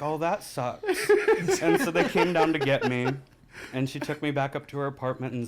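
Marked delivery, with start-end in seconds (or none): 0.0-3.1 giggling through mom's shrug; laughing. Real, not acted. Quoting mom's reaction to the stabbing, laughing.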